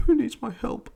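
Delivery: mock blubbering in a high voice; high, hammed-up crybaby imitation; Andrew Garfield. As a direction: whimper it in exaggerated tearful falsetto. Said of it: "you get blubbery fucking crying".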